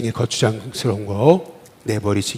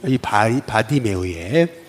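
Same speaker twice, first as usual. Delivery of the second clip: ordinary talk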